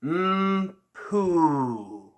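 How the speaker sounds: "mm, poo" with pouty, pursed lips; mm poo embouchure. Shown as a demonstration. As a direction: shape "mm, poo" slowly, lips pushed forward. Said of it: "saying the words".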